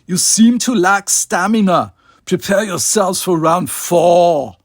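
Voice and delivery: high, loud, slow and jovial; the dictator calling down to the beaten fighters, jovially. Acted. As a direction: savour it like a cruel host. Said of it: "his voice still jovial".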